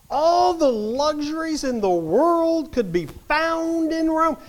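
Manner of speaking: fiery preaching to the congregation; preaching at full force. Acted. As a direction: hammer home the luxuries of Rome. Preacher builds to a climax.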